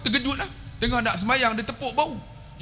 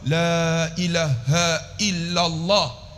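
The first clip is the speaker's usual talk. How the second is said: "la ilaha illallah" very slow, every long vowel held; careful, drawn-out; much slower than usual. Shown as a demonstration. Preacher modelling proper zikir, "la ilaha illallah" slow and careful. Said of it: "Sebut elok".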